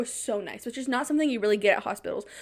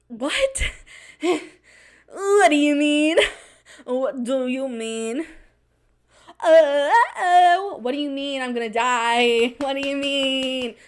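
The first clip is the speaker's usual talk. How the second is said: high, loud, panicked repeating of what; panicked disbelief; 3 semitones higher, 5 dB louder, much slower than usual. Retold or imitated. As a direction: stretch out the stunned questions in mock panic. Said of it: "And I was like".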